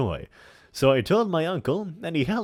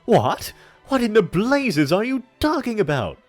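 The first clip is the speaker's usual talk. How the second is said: put-on loud, indignant, higher old man; hammy stage actor; 12 semitones higher, 9 dB louder than usual. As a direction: bellow it with theatrical outrage. In-character outburst.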